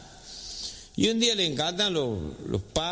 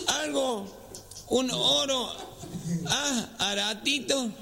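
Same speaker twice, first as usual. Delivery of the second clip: halting nasal request, pitched up; gangoso; 4 semitones higher, slower than usual. Acted. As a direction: imitate a nasal speaker struggling out each word. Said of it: "medio gangoso era". (voz gangosa, aguda y entrecortada)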